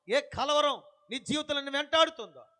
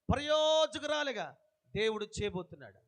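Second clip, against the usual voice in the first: normal talking voice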